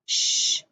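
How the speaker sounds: "sh" as a voiceless hissed sh, no vowel; just hiss "sh"; teacher models the sound "sh"; isolated sh sound. Shown as a demonstration.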